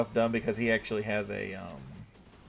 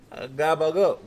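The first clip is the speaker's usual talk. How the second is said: a loud, higher mock Lindo voice; imitating Lindo; 5 semitones higher, 8 dB louder than usual. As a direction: ham up a silly take on your friend's talk. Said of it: "That's how I imagine Lindo talks".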